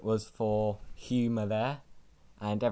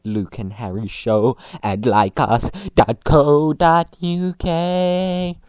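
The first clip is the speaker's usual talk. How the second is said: louder, spelling the address out firmly; loud clear repeat; 6 semitones higher, 12 dB louder, slower than usual. Acted. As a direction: announce it again, loud and clear. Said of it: "I will repeat that".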